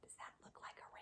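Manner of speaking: breathy hushed question; whispered aside. Real, not acted.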